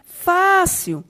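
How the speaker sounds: first syllable of "fácil" loud, high and drawn out; stress on FÁ. Shown as a demonstration. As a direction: punch the FÁ in "fácil". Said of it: "a sua sílaba tônica é o fá". (ênfase forte no FÁ de "fácil")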